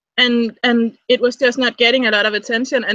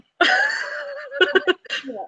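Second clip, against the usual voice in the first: high laugh; 4 dB quieter than usual. Real, not acted. Laughing at friend.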